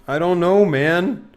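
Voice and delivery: hammed-up dude panic; mock dude voice. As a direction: whine like a lazy slacker. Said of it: "Slowpoke Rodriguez impression".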